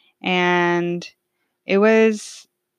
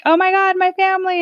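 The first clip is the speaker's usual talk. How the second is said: much higher, louder gushing cry; excited reunion; 12 semitones higher, 5 dB louder than usual. Retold or imitated. Back from Sweden greeting her family, overjoyed and loud. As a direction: squeal it like seeing family again.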